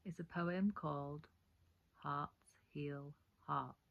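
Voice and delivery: "Hearts Heal Hearts" spelled out very slowly, word by word; slow right down and place each word of "Hearts Heal Hearts"; slow careful correction. Shown as a demonstration. Poet correcting her title to viewers: very slow and deliberate. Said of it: "It's a tongue twister".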